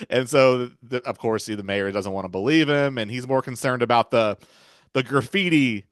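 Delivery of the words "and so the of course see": normal talking voice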